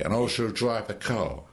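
ordinary talk, nothing marked

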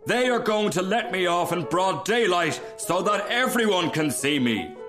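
glory in being the centre of attention; delighted cry. A narrator's reading. Rocket expecting an audience, proudly excited. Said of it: "cried the Rocket".

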